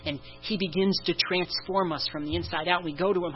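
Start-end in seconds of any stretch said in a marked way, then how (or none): none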